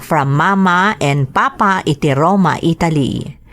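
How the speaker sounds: ordinary delivery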